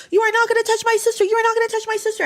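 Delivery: high, fast, pressing threat; fierce protective warning. Retold or imitated. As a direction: imitate yourself standing up for your sister. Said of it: "I was like".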